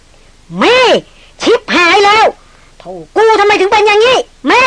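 high, panicked calling out to her; desperate shout over a fallen friend; frantic cry. Acted. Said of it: "แผดเสียงเรียกละล่ำละลัก".

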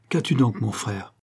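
usual speaking voice

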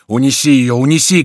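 loud, urgent command; shouting. A narrator's reading. Bussy begs Remy to carry Diana off, urgent shout. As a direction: shout it insistently, rising on the repeat. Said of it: "кричал Бюсси".

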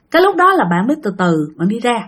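plain reading voice

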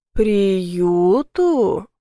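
low growl through clenched teeth. A narrator's reading. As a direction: growl it back in disbelief. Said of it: "прорычал сквозь зубы".

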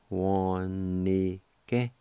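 "Wanige" stretched into three long syllables; drawn-out pronunciation. Shown as a demonstration. Teacher repeating the word for learners, syllables drawn out. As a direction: draw out "Wanige", sounding the I in "ni". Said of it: "it's pronounced like this".

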